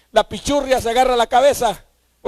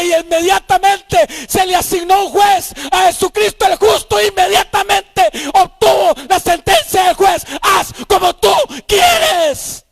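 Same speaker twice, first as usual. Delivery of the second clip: high, loud triumphant shout, the last line slow; preaching at full force; 8 semitones higher, 5 dB louder than usual. Acted. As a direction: proclaim the victory at full voice.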